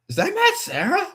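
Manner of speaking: high, surprised squeak of a question; surprised double take. Retold or imitated. Fan spotting a famous fighter in the corridor, high surprised squeak. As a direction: gasp it out like you can't believe who it is.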